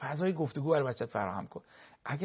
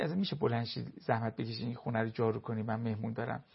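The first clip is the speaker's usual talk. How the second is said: soft gentle request; 6 semitones lower, 8 dB quieter than usual. Retold or imitated. Wife holds husband's hand, asks.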